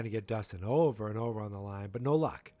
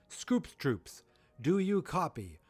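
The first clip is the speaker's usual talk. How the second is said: radio caller; 6 semitones higher, much slower than usual. Retold or imitated. Imitated walkie-talkie call.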